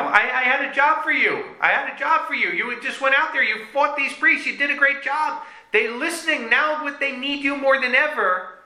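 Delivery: high-pitched, insistent scolding; exasperated rebuke. Acted. God confronts Eliyahu over the job he left, exasperated.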